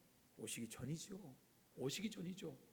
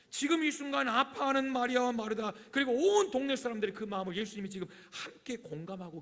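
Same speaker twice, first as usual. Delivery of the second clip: loud, raised pulpit delivery; preaching; 6 semitones higher, 8 dB louder than usual. Real, not acted. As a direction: press the point with fervour. Preaches at full force. (힘주어 강조하는 설교)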